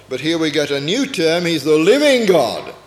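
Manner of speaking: ordinary delivery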